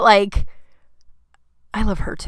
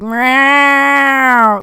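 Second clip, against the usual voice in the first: long, loud imitated yowl; drawn-out wailing meow, pitched up; intense cat meow; 5 semitones higher, 7 dB louder than usual. Imitating own cat's awful meow, intense. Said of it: "His meow is pretty intense".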